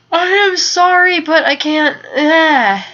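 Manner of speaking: loud, high apology through a yawn. Real, not acted. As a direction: apologize while helplessly yawning. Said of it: "I keep yawning".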